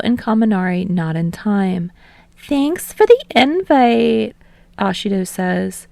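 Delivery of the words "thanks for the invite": cheery thanks. Acted. Ashido thanks hostess.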